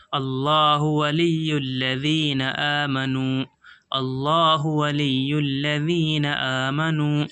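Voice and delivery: slow, measured tajweed recitation; slow Quran recitation. Shown as a demonstration. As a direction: draw the words out slowly, chanting. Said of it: "teacher recites the full phrase".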